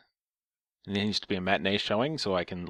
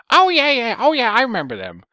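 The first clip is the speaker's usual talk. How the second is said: very high, loud squawk; screechy caricature of an old woman; old lady voice; 11 dB louder than usual. Pretend. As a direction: gush recognition as a mock old lady. Imagined old lady's reply.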